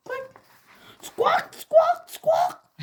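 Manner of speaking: very high squawking chicken; high hammed-up chicken calls. A narrator's reading. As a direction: squawk like a hen, loud and shrill-pitched. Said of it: "cackled Margaret".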